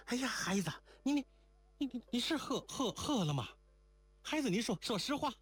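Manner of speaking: high, stammering panic; panicked. A narrator's reading. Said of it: "真慌了神儿". (慌了神，高声结结巴巴地追问)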